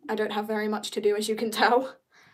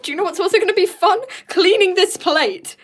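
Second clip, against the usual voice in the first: sarcastic excitement; 8 semitones higher, 7 dB louder than usual. Acted.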